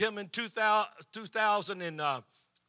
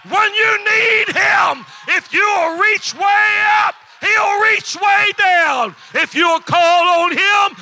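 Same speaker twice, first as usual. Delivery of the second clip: high, loud, slow preaching shout; full-force preaching; 13 semitones higher, 16 dB louder, slower than usual. Acted. Pastor rousing the congregation, full-force preaching shout. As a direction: preach it to the rafters.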